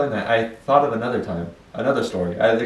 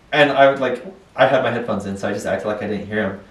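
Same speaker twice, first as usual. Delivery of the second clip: ordinary talk, nothing marked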